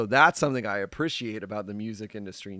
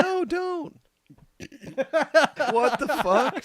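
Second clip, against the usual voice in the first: high, squeaky mock plea; mock pleading; 5 dB louder, slower than usual. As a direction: whine like a panicked liberal. Mocking pleading voice.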